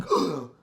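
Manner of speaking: a sudden loud gasp of realization; gasp, as if jolted awake; sharp gasp. Real, not acted. Waking up from a stupor.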